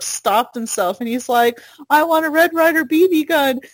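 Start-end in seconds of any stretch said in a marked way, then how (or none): 1.9-3.6 put-on high, fast kid's blurt; blurting kid imitation. Nervous kid finally telling Santa his wish, high rushed blurt.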